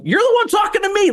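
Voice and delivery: high mocking reply to annoy the man; antagonizing high voice. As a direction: needle him in a squeaky mock tone. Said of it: "I'm like".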